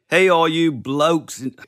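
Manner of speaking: a put-on Aussie greeting that slips toward Cockney; botched Australian accent that sounds like Oliver Twist; Australian accent attempt. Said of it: "That sounded like Oliver Twist".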